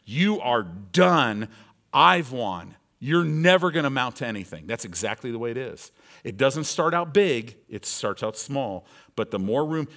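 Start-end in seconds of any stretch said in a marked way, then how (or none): 0.1-4.7 roaring devil. Acted. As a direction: roar it at them like a victorious enemy. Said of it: "It'll roar in your mind".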